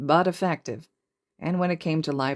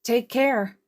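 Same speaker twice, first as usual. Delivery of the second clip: chirpy goodbye; 8 semitones higher, 4 dB louder than usual. Acted. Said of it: "Uraraka chirped".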